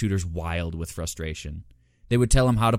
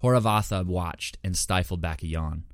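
usual speaking voice

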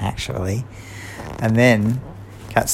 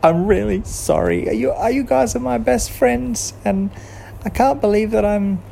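higher, sorry voice of Kat; Kat apologising; 12 semitones higher than usual. A narrator's reading. Kat to Girl and Mouse, higher and sorry. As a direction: plead sorry to best friends. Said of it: "Kat said to Girl and Mouse".

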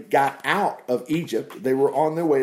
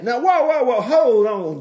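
excited whoa; 7 semitones higher, 4 dB louder than usual. Real, not acted. Preacher halts the quoted plea.

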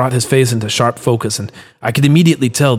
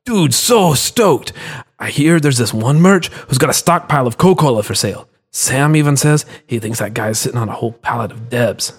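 stoked; 2 semitones higher than usual. Acted. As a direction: savour every word with a manic grin. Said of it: "manic grin".

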